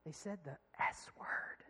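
secretive, slow whisper; hushed little confession; imitated child's whisper. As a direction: whisper it like a child confiding a naughty word. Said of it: "she whispers and she said".

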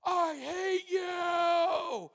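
long, high, wailing scream; very slow, drawn-out tantrum yell; retold child's yell. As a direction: mimic a furious kid screaming from the stairs. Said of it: "and he yells".

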